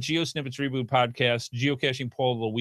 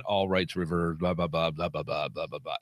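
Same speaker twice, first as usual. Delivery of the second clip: low flat droning disclaimer; monotone; 10 semitones lower, 5 dB quieter than usual. Retold or imitated. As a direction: drone it out, dropping lower. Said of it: "that monotone".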